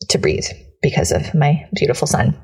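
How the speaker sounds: ordinary talk, nothing marked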